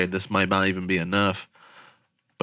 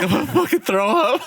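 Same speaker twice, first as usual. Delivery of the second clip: cracking up, much higher; laughing. Retold or imitated. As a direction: squeal it through laughter. Quoting himself amid laughter.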